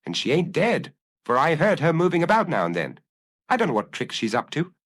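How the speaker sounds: lightly voiced grudging servant; a hint of sulky woman; sullen. A narrator's reading. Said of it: "in her sullen way".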